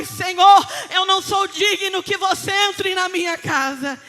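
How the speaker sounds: loud, fervent, mounting proclamation; full-force preaching. Acted. Preacher promising the church God's authority, fervently building.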